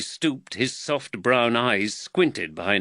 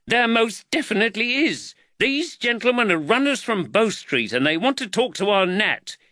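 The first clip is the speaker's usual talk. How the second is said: high, indignant complaint; irate wife, voice pitched up; angry woman; 12 semitones higher, 3 dB louder than usual. A narrator's reading. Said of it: "said the angry woman".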